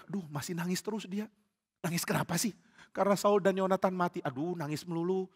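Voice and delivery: soft, private grumbling; hushed aside. Acted. Followers remarking on David's endless crying, hushed aside. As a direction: keep it down so he won't hear.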